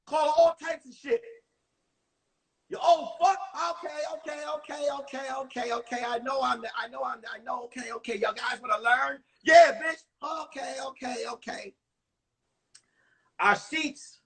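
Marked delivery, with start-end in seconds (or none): 2.7-3.4 high-pitched mock insult; cursing student. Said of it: "We would curse her out". 3.7-9.2 put-on soft, flustered old lady repeating herself; old lady teacher. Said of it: "She talked like this". 9.4-10.0 loud, high heckle; cursing student. Retold or imitated. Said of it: "We would curse her out". 10.2-11.8 put-on very soft, meek old lady; old lady teacher. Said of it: "She talked like this".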